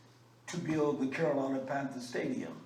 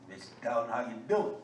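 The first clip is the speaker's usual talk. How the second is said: country greeting; 6 semitones lower, 3 dB quieter than usual. Shown as a demonstration. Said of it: "That's the way I would say it".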